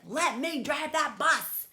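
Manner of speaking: be the pigeon insisting on the bus; storybook pigeon begging for his way; pushy pigeon. Acted. Pigeon demanding to drive.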